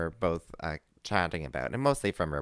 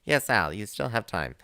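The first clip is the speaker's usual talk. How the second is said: ordinary talk, nothing marked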